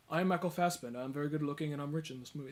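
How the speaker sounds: understated emotionally numb delivery; Michael Fassbender. Retold or imitated. Mimicking the actor's numb early performance, lightly deadpan. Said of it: "he's just very emotionally numb".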